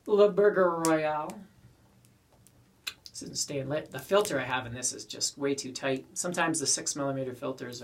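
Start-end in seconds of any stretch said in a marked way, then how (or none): 0.1-1.4 put-on slow, hammy French accent; mock French accent. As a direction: say it like a Frenchman, drawn out. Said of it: "they call it".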